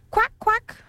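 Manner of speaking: high quacking cry; duck quack call. Acted. Mother duck calling her brood into the water, high quack. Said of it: "cried she".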